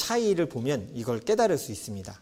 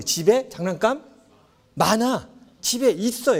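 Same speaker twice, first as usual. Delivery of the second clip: reassuring parent; 7 semitones higher, 4 dB louder, slower than usual. Retold or imitated. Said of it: "얘기해요".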